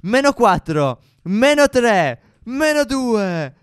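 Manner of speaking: building countdown to a loud cheer; excited countdown. Real, not acted. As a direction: count down like a sports commentator. Countdown to the final jump.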